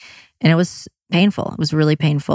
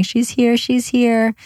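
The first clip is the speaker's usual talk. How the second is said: higher, overjoyed mock sob; tearful joy; 7 semitones higher, slower than usual. New father weeping over his daughter, higher and overjoyed. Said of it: "he kept saying".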